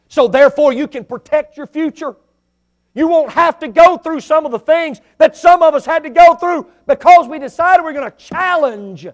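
loud, high, driving preacher cry; preaching at full force. Real, not acted. Pastor urging the church at full force, loud and high. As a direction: lift it up and hammer it home. Preacher climaxing on sowing, reaping.

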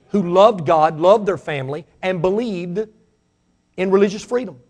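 ordinary talk

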